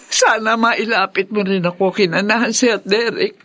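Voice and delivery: ordinary talk, nothing marked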